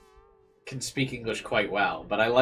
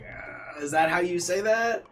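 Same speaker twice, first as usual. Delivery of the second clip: mock hesitation rising higher; unsure reader; 8 semitones higher, 3 dB louder, slower than usual. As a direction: imitate someone doubting their own pronunciation mid-line. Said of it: "he's, like, reading the line".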